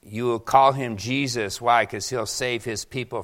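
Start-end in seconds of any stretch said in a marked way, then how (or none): none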